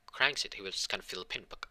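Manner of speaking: plain reading voice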